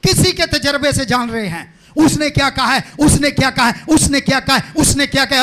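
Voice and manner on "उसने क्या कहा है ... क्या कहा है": impassioned preaching. Real, not acted. Preacher urging people to follow their own heart, building shout. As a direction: build from insistent repetition to a shout.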